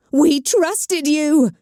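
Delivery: a slow, high, loud accusing shout; betrayed cultist shouting at her leader. Acted. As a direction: hurl it at him, dragging each word. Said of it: "Evelyn Dark shouted".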